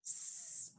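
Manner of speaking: hissed s sound; just the hiss of S, no vowel; s- as a soft hiss; letter sound s. Shown as a demonstration. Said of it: "S says".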